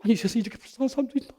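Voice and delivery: ordinary talk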